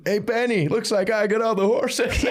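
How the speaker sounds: mock high-pitched call-out; high, teasing movie-line impression; film quote. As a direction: crow it like a movie taunt, drawn out at the end.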